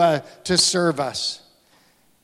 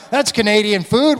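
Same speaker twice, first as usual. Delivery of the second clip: ordinary talk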